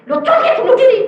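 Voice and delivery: high, loud, incredulous outburst; retold yelp of alarm; alarmed protest. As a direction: blurt it out high and loud with a laugh. Protesting aloud in retold exchange.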